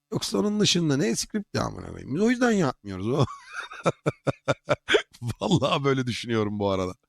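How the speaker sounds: talking around a hard candy; words slurred by candy in mouth; sucking a hard candy. Real, not acted. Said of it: "Ağzına ne oldu?".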